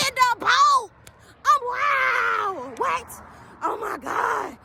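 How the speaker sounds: high yelled gasps of shock; high, yelled shock at what happened; shocked disbelief. Real, not acted. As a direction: react as if you can't believe it. Reacting to the bonfire in the boat.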